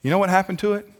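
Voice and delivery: ordinary talk, nothing marked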